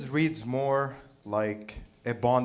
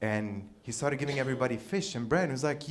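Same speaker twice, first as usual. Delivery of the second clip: normal talking voice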